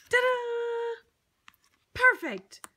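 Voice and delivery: high, loud cheerful reveal; triumphant ta-da. Acted. Revealing the result to the child, loud and thrilled. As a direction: announce it like a magic trick. Reveal moment in picture book.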